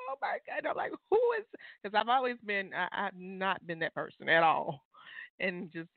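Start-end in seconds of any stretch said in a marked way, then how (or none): 0.1-1.5 high-pitched overwhelmed squeak; mock weepy. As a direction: whimper it as if touched to tears. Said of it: "I cry all the time now".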